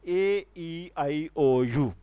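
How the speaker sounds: ए, ई, आई, ओ, यू each said apart and clearly; vowel letters spelled out. Shown as a demonstration. Teacher lists the five vowels to the class, each clear. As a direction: count off ए ई आई ओ यू one by one. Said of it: "पांच होते हैं".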